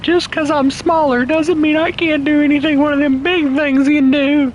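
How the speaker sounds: very high, squeaky little engine voice; little engine that could. Pretend. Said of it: "I sound like the little engine that could".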